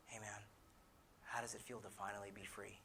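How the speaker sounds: soft, low, tender question; gentle reassurance. Retold or imitated. Friend reaching out to a man confessing shame, gently. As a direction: ease it out quietly, like a hand on the shoulder.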